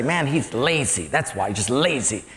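acted grumble, low and offhand; muttered disapproval. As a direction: pass judgment on a coworker under your breath. Mock gossip about a coworker.

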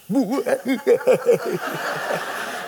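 imitated weird gloating gurgle; gurgling laugh. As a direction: mimic a prankster's odd laugh through a door. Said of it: "weird kind of gurgling laugh".

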